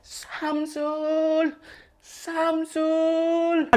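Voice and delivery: called from far off; distant calling. Acted. Parent searching the village, calling from far away. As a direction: call his name across the village.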